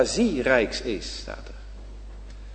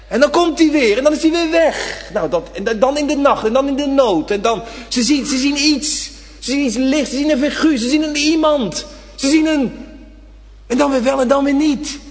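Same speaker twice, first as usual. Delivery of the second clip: higher and louder, breathless wonder; preacher painting the flickering figure, rising; mounting excitement; 9 semitones higher, 4 dB louder than usual. Acted. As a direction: build the suspense, faster and rising. Vivid retelling of ghostly sight.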